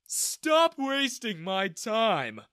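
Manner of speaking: loud, slow, high yawn through the words; drawn-out, yawny dismissal. A narrator's reading. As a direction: stretch the words out in a bored yawn. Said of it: "he yawned".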